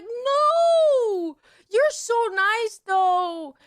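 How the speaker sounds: high, slow wailing protest; hammed-up woozy heartbreak; drugged-out dismay. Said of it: "me being fucking drugged out of my mind".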